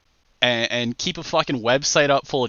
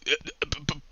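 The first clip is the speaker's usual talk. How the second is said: stammering Andy; 5 semitones higher, 5 dB louder than usual. Retold or imitated. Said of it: "Andy would be like".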